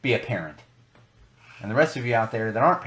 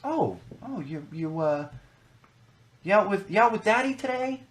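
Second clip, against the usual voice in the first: imitating a cooing older cashier, much higher; sweet cashier voice; 9 semitones higher than usual. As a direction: mimic a gushing checkout lady talking to a toddler, pitched way up. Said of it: "she goes".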